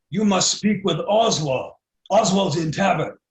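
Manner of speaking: loud, slow half-orc in broken English; commanding half-orc. Acted. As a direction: give a blunt order as a half-orc. Game master voices terse half-orc.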